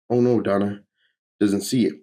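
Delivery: usual speaking voice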